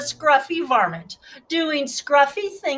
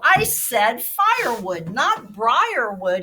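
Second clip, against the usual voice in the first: plain reading voice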